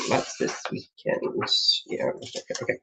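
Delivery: very soft, voiceless mumble; half-whispered checking to yourself; barely audible, slowed self-talk; talking to oneself. Real, not acted. Checking things, talking to herself.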